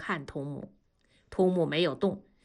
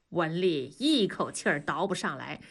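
usual speaking voice

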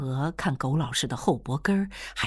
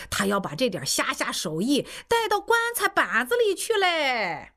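put-on higher, needling old man's voice; old storeroom keeper teasing; 9 semitones higher than usual. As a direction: poke fun at the young girl like a cheeky old codger. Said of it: "看库的老汉就在一边说". (看库老汉逗弄易清娥，语调偏高、带点挖苦)

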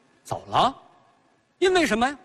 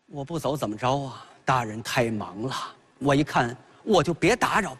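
sulky scholar; 7 semitones lower than usual. Acted. Gongsun answers sulkily, lower voice.

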